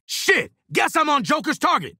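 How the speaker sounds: high-pitched alarmed shout; alarmed callout. Acted. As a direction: yell that you're under attack. Targeted by enemy in combat.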